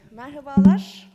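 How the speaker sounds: normal talking voice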